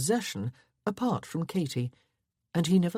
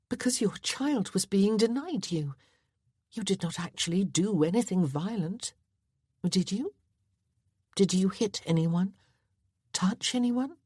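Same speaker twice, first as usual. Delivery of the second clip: lightly raised ladylike voice; a touch higher, a refined young lady; Miss Westcott voice; 5 semitones higher than usual. A narrator's reading.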